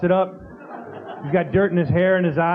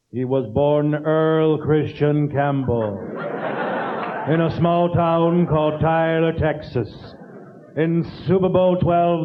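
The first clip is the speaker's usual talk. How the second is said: NFL Films narrator; 2 semitones lower, much slower than usual. Retold or imitated. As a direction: intone like an epic football film. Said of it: "the guy with the voice".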